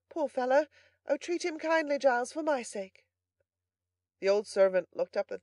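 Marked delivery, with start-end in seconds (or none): 0.1-3.0 high, pitying female voice; compassionate young lady. A narrator's reading. Young lady begging the butler to be kind, pitying plea. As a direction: plead tenderly on the boy's behalf.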